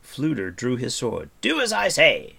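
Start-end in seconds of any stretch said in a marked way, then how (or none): 1.4-2.3 high, loud, forceful order; shouted command. A narrator's reading. Bard overruling hesitant Taran, shouting. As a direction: shout it, allowing no argument. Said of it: "shouted the bard".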